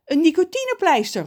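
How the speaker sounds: high, loud exclamation; calling out. A narrator's reading. Ron recognises the plaster, calling out high. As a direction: call it out in recognition. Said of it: "roept Ron".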